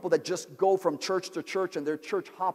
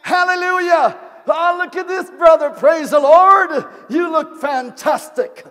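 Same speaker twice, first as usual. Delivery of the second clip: a churchgoer fawning over a brother with hammed-up praise; gushing flatterer; 10 semitones higher, 12 dB louder than usual. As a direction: pile on the fake compliments.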